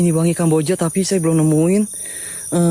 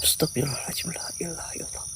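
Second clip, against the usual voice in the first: frightened whispered dhikr; whispered prayer; 14 dB quieter than usual. Real, not acted. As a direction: breathe the prayer in fear. (doa berbisik ketakutan)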